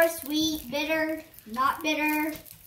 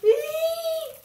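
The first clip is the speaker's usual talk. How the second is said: high squeal at a sour taste; 12 semitones higher than usual. Retold or imitated. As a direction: shriek at the sour lemon. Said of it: "When I was a baby, I did a lemon".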